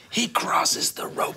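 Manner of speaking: whispered spoiler; whispering. Acted. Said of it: "Spoiler alert".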